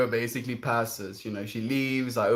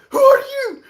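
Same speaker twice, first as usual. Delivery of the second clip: imitated shriek, very high and loud; mock alarmed high shout; startled yell; 11 dB louder than usual. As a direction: act out a shocked stranger yelling through a door. Acts startled stranger behind door.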